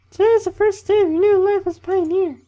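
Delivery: loud, squeaky falsetto camp leader giving a speech; hammed-up mock female falsetto; bad girl voice. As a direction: lecture in a badly faked, very high female voice. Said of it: "That was a really bad girl voice".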